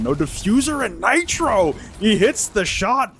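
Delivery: rising hype as the shot lands; esports caster. Real, not acted. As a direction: call the clutch with mounting excitement. Clutch round called live.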